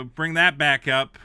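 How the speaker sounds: usual speaking voice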